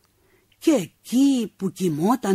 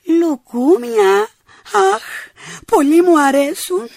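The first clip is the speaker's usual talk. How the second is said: put-on delighted old woman; 10 semitones higher, 7 dB louder than usual. Deaf old woman mishears, delighted.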